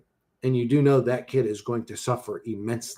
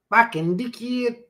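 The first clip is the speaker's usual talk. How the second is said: slow, high Aussie insult; Australian accent; 8 semitones higher, slower than usual. Acted. Streamer scolding poor parents, mock Australian accent. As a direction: drawl it as a mock Australian put-down. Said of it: "the way they say fucking dickhead".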